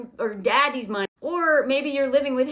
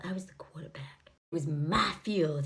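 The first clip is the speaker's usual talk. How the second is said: mock smouldering brag; 10 semitones lower, 8 dB quieter than usual. Man bragging about his quarterback days, mock smouldering. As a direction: murmur it like a self-important heartthrob.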